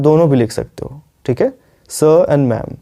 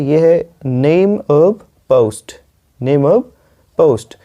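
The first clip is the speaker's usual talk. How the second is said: normal talking voice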